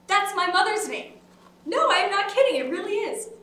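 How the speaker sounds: high, loud delighted surprise; excited amazement. Acted. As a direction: gush over an amazing coincidence. Amazed at a coincidence of names.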